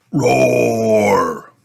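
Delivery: playful dinosaur roar; toy dinosaur roar. Acted. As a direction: roar the word out, falling away. Singing toy dinosaur roars.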